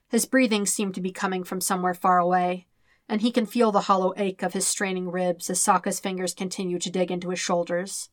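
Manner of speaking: plain reading voice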